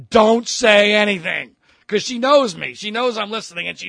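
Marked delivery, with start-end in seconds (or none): 0.1-1.6 loud slow warning; warning quote. Retold or imitated. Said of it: "comes to my office and says".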